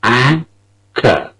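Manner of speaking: "Anchor" with a long stressed AN and short kor; "Anchor" with the final R dropped. Shown as a demonstration. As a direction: hit the first syllable of "Anchor". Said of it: "Listen to it again".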